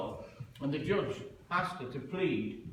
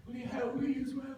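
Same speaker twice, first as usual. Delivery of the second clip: high pleading whine; 11 semitones higher than usual. Retold or imitated. Pleading to passersby.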